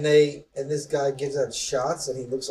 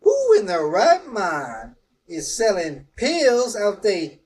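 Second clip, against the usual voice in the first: mock outrage; 7 semitones higher, 8 dB louder, slower than usual. Mock formal indignant question.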